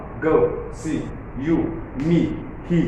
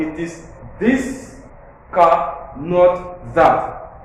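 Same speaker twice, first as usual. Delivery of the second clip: "this" slowed and lifted, "not that" louder; contrastive stress; 3 semitones higher, 4 dB louder, much slower than usual. Shown as a demonstration. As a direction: stress "this" against "that", slow then firm. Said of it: "The contrast".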